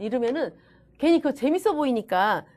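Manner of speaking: usual speaking voice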